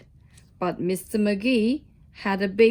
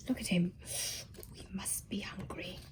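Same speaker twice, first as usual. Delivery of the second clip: whisper; 5 semitones lower, 15 dB quieter, faster than usual. Real, not acted. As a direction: lean in and whisper it. Reader's aside to child listener.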